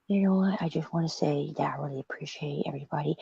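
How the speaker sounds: soft, breathy, sincere whisper; hushed whisper. Retold or imitated. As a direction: whisper it politely into the phone. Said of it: "to politely just keep it quiet".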